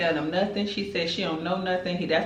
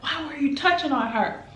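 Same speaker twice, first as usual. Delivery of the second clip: a pitched-up mock yell at him; mock scream; 9 semitones higher than usual. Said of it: "Robert screams out".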